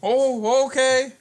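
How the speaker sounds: high, loud innkeeper voice; bartender voice. Acted. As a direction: play the chirpy bartender.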